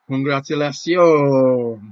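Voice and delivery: drawn-out loud congratulation; slow loud cheer. Real, not acted. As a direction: stretch it out in triumph. Celebrating the win live.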